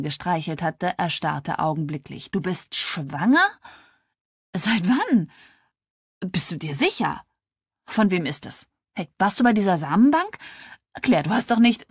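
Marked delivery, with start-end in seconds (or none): 2.3-11.9 rising, incredulous barrage of questions; shocked questioning. Acted. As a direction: grill your friend in growing disbelief. Shocked friend fires off questions. (fassungslos und aufgeregt nachfragend)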